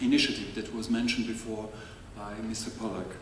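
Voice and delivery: usual speaking voice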